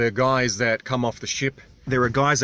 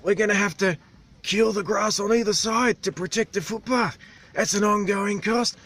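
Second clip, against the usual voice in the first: higher, strained local voice; islander's animated voice; 10 semitones higher than usual. Retold or imitated. Vlogger imitates islander ranting about vests and boots, strained high. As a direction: push the voice up like the animated old islander.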